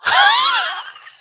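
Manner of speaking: high, loud shriek of laughter. Real, not acted. Watching her rat clip, loud shrieking laugh.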